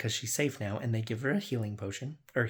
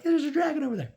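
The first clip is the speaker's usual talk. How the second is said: much higher, urgent call-out; excited warning; 4 dB louder, faster than usual. Retold or imitated. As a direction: point it out like a spooked scout. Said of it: "So they're like".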